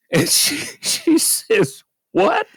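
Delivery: high, breathy laughing "what?"; cracking up retelling her shock; giggly mock shock; laughing disbelief. Dispatcher's shocked reaction, laughing.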